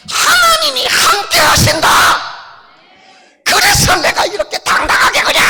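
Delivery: high, bold little puppet boasting. Acted. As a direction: brag proudly as the puppet doll. Puppet proclaims God's child. (당당하게 자랑하는 높은 인형 목소리)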